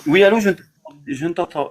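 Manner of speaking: ordinary delivery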